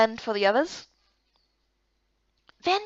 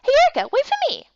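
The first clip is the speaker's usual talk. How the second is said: high, fast call to wait up; eager call; 13 semitones higher, 5 dB louder, faster than usual. Acted. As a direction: hurry after her calling out. Small friend calling out, running.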